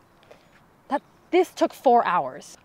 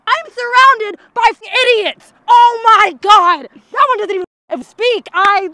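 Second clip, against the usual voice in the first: louder and higher, furious rant; exasperated yelling; 7 semitones higher, 9 dB louder than usual. Acted. Leader yelling at her useless party, louder and higher. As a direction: blow up at the idiots around you.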